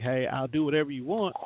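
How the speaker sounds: ordinary delivery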